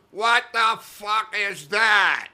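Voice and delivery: high, drawn-out angry demand; acted outrage. Club boss seeing the painted names, in acted outrage. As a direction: stretch each word in hammed-up fury.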